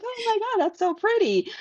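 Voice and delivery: very high, mock gushing squeal; gushing customer. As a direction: imitate a customer cooing over a pretty name. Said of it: "But they're like".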